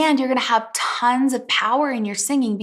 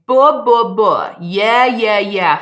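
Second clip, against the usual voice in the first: loud chest-voice buh and yeah syllables; strong chest resonance exercise; chest voice resonance demo; 9 dB louder, slower than usual. Shown as a demonstration. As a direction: resonate it in the mouth, full and loud. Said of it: "just say".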